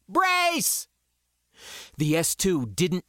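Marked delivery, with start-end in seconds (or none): 0.1-0.9 high, urgent shout; sharp cry, pitched way up; shouted warning. A narrator's reading. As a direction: shout a command to take cover. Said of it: "I shouted".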